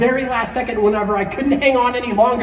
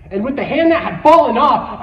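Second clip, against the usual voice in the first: normal talking voice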